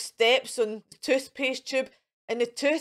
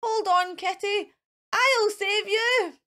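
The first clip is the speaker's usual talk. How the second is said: heroic shout; 8 semitones higher than usual. Acted. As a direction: call up to the tree to save her. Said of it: "shouts Mister Wolf".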